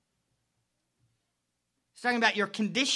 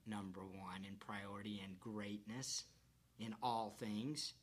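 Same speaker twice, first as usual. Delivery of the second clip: whispered; 10 semitones lower, 16 dB quieter than usual. Real, not acted. Whispered emphasis.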